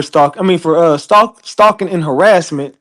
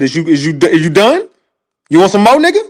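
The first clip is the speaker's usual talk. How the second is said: taunting; faster than usual. Retold or imitated. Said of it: "And then said".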